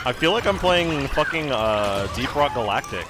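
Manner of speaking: loud, high, laughing outrage; frantic yelling. Real, not acted. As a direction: shriek at the useless pet.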